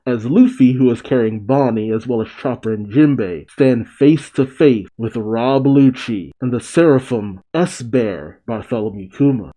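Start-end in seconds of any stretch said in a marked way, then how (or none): none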